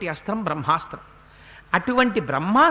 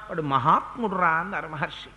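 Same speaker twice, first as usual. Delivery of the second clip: ordinary talk, nothing marked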